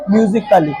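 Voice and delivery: "म्यूजिकली" with a short "klee" ending; silent A. Shown as a demonstration. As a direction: model "म्यूजिकली" without sounding "ai". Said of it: "क्या पढ़ेंगे?". ("म्यूजिकली" में ए silent रखकर)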